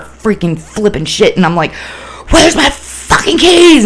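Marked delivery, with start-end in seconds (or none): 2.3-3.8 high, drawn-out furious shout; angry manic yell. Retold or imitated. Re-enacting losing her keys in a manic episode, angry yell. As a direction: explode into a full-out, high-pitched yell. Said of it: "this anger would just".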